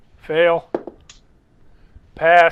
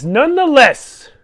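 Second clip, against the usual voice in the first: Mother Reeve; 10 semitones higher, 5 dB louder than usual. Acted. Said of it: "Mother Reeve says".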